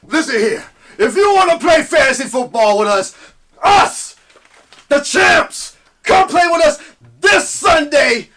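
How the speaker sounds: loud, high hyped wrestling promo. Acted. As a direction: shout it like a pro wrestler cutting a promo. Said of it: "Let me do it in my wrestling voice".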